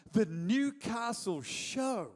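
slow, drawn-out mock-grand reveal; grand announcement. Friend revealing big news in the car, grandly. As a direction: announce it like a huge event, stretching each word.

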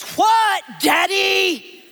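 put-on high, loud teenage daughter holler; hammed-up daughter's shout; yelling back. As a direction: holler back, annoyed at being summoned. Daughter yells back to father.

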